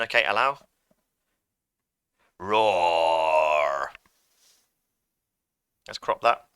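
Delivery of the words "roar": low, drawn-out bear roar; recorded bear roar. Acted. Teacher recording a sound effect, low bear roar. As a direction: growl it out like a hungry bear. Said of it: "And I'm gonna go".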